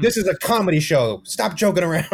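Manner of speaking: loud, high-pitched pretend scolding; mock outrage. Comic playing an offended objector at a comedy show, mock outrage. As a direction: scold the room in hammed-up indignation.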